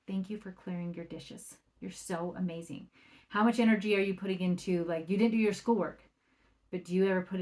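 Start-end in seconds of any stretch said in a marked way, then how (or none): 0.1-2.9 soft thanks. Retold or imitated. Thanking kids for clearing dishes.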